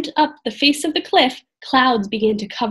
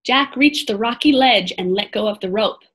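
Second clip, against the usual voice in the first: plain reading voice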